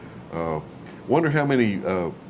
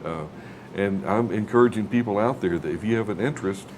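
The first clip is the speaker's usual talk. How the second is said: usual speaking voice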